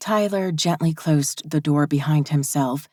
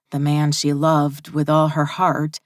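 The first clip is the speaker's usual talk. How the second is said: ordinary delivery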